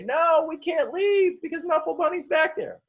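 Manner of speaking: put-on pleading toddler. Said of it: "doing everything she can to say".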